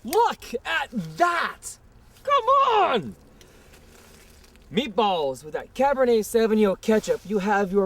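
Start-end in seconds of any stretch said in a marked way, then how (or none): 0.0-3.2 high, loud, slow wow of amazement; excited exclamation. Real, not acted. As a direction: gush over the food, stretching each word. Host reveals finished meatballs at table.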